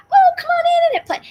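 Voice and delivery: very high, fast sing-song mock welcome; mock British welcome. British people welcoming soldiers, very high, fast and sing-song. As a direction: trill it like an eager host. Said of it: "the British people were like".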